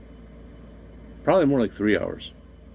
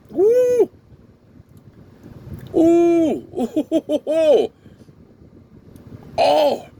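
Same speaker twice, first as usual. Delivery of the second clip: high, loud whooping at the heat; excited whoops; 6 dB louder, much slower than usual. Real, not acted. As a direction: yelp from the spice burn. Reacting to the spicy vinegar.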